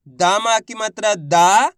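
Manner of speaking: slow spelling, final दा stretched long and rising; drawn-out vowel. Shown as a demonstration. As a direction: stretch the last दा loudly. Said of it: "तो खींच के पढ़ेंगे".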